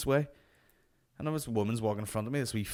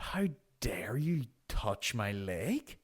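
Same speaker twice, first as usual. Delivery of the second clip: slow, hissed mock indignation; hammed-up scandalised whisper; whispered outrage; 4 dB quieter, much slower than usual. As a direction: whisper it slowly, deeply affronted. Imagined outraged look of train passenger.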